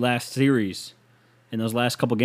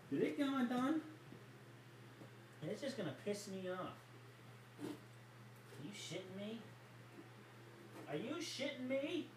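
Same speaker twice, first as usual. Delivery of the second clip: far off mic; 10 semitones higher, 23 dB quieter than usual. Real, not acted. Muttering walking off-mic.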